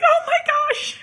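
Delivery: high laughing oh my gosh; exasperated high squeal. Retold or imitated. Mom squealing at her son's critique. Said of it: "And I'm like".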